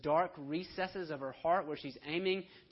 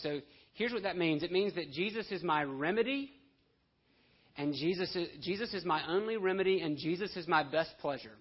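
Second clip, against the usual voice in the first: louder, driving sermon delivery; preaching with force; 2 semitones higher, 6 dB louder than usual. Acted. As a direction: press the point home from the pulpit.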